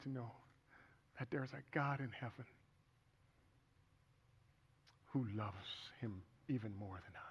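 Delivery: very soft, with heavy pauses; real subdued grief. Real, not acted. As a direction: fade almost to a whisper in grief. Father speaks of his dead son.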